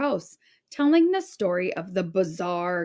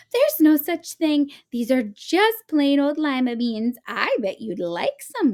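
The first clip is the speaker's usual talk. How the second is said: sweet, higher granny voice; kind old woman; 6 semitones higher, 3 dB louder than usual. A narrator's reading. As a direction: coax like a kindly grandma. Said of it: "replied the kind old woman".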